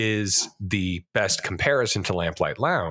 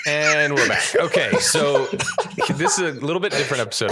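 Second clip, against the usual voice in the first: show host intro; 7 semitones higher, 3 dB louder than usual. Acted. Reopening the show after the bit.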